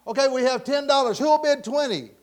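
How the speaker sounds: loud, high bid-taking cry; auctioneer's call. Acted. Auctioneer to the crowd after a bid, calling out loudly.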